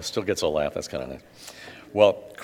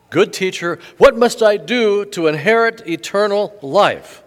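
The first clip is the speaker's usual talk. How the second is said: higher and louder, an eager supplicant; pleading rich man; 9 semitones higher, 8 dB louder than usual. Acted. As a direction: call out begging to the teacher. Rich man begs Jesus.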